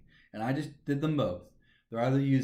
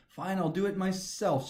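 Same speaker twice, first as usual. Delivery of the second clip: MYSELF pitched up, then falling; higher-pitched retort with emphasis on "myself"; stress on myself; 4 semitones higher than usual. Acted. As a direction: lean on "myself" in an emphatic reply. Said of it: "'cause I can hear the emphasis".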